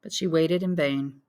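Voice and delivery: ordinary delivery, nothing marked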